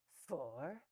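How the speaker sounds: a loud, rising Four?; astonished neighbor; surprised exclaim. A narrator's reading. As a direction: she can't believe the number. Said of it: "exclaimed the neighbor".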